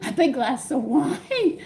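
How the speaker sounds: slow, giggling through the words; laughing. Real, not acted. Joking to viewers about wine after chores, laughing. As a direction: laugh it out as a confession.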